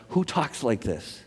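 soft, understated disbelief; quietly incredulous, falling at the end; hushed rhetorical question. Real, not acted. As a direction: drop the volume and let the question land. Rhetorical question repeated after listing claims.

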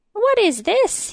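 high, swooping croak; croaking king. A narrator's reading. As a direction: croak it out in indignant surprise. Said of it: "he croaked".

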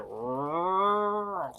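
long drawn-out imitated gurgle; horrifying gurgling sound. Showing a friend what you hear on screen, horrifying gurgle. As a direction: gurgle like a dying movie creature. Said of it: "the most horrifying gurgling sounds".

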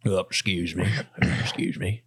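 low, soft, hushed excuse me; quiet apologetic character voice; hushed polite voice. Acted. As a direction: whisper it politely past the bodies. In-game character speaking.

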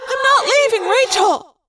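high, desperate refusal; plead, refusing to go; cried out. Acted. Cassie refusing to abandon Rachel, high and desperate. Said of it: "Cassie cried".

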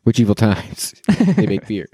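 usual speaking voice

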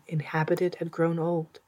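plain reading voice

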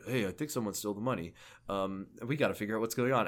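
low, quick, softened quote; quietly and hurriedly toss it out, low; casual heads-up. Retold or imitated. Kiryu telling Haruka about stolen money, low and hurried. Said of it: "he tells Haruka, like".

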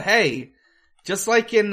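usual speaking voice

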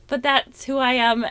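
ordinary talk, nothing marked

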